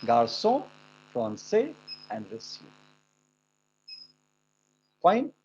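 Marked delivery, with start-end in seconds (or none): 0.1-2.7 Garçon, français, reçu said lower, with a French s; a low, trailing Garçon, français, reçu; French model words. Shown as a demonstration. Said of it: "सो, सा, स्यू है".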